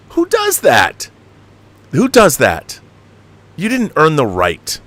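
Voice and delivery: squeaky high, slow outraged cry; incredulous mock shriek; exasperated rant. Real, not acted. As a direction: ring out in disbelief. Ranting about film catchphrase.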